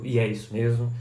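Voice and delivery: usual speaking voice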